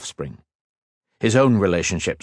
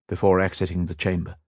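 ordinary delivery, nothing marked

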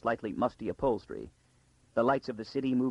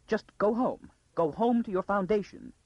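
high, urgent plea; frantic urging; 9 semitones higher than usual. A narrator's reading. Lady urges the girl to flee home, frantic urging. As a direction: send her away in an urgent, raised pitch.

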